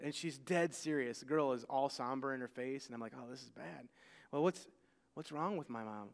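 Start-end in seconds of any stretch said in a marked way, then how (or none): 3.2-6.1 hushed inner voice. Retold or imitated. Inner thought, retold.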